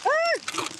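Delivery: short high squeal of delight. Real, not acted. Seller seeing a duck, high squeal. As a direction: squeal at the sight of it.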